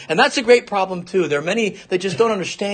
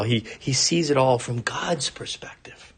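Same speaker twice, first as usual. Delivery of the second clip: hushed; 7 semitones lower, 6 dB quieter than usual. Real, not acted. Preacher drops to a hushed aside.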